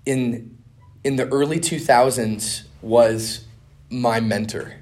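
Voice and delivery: ordinary talk, nothing marked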